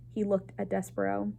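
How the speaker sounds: ordinary delivery, nothing marked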